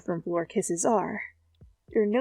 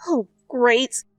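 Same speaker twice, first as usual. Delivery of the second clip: ordinary delivery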